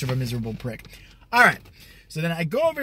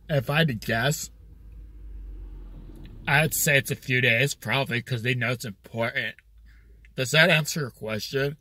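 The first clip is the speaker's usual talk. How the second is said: dopey manager; 4 semitones lower than usual. Retold or imitated. Manager voiced in retold story.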